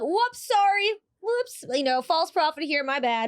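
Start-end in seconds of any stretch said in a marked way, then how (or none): 0.0-1.6 breezy oops. Pretend. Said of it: "Just be like".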